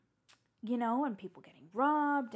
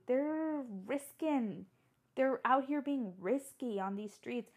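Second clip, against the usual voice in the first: ordinary delivery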